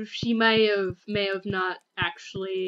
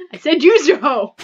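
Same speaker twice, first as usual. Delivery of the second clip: loud, higher repeat through laughter; laughing insistence; 3 semitones higher, 12 dB louder than usual. Real, not acted. Friend clarifies a misheard farming instruction, loud and laughing. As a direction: blurt it louder, cracking up.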